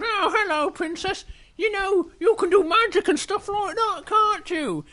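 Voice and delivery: very high little-creature voice; Little Bear. Acted. Little Bear asks princess about magic, high-pitched. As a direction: pipe up high as a tiny bear. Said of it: "And Little Bear goes".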